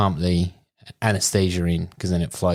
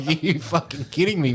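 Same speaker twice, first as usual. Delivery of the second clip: shocked disbelief; 7 semitones higher than usual. Retold or imitated. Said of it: "I'm like".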